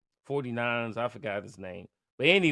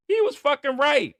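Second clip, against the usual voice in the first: much higher and louder, exasperated; loud high-pitched admission; exasperated outburst; 14 semitones higher, 8 dB louder than usual. Real, not acted. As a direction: blurt it out in disbelief. Admits friend was right.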